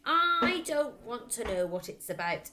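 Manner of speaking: loud angry bark cutting her off. A narrator's reading. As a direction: shut her down like a furious father. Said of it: "Mister Wormwood barked".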